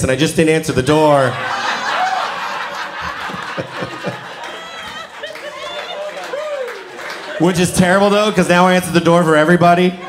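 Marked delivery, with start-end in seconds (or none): 1.3-7.3 long high-pitched giggle; high cackle. Real, not acted. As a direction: let out a long squeaky laugh.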